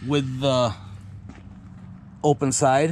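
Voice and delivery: ordinary talk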